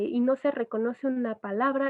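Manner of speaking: normal talking voice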